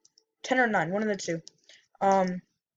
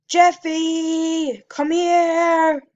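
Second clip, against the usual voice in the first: calling out; 8 semitones higher, 7 dB louder, much slower than usual. Retold or imitated. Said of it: "all she said was".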